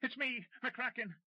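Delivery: high, slow, alarmed cry. Acted. McCracken caught by guards, calling out in alarm. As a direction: blurt out your name in sudden fear. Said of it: "an alarmed voice said".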